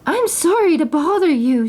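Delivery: lightly voiced timid princess; princess's voice. A narrator's reading. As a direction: apologise sweetly. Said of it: "said the princess".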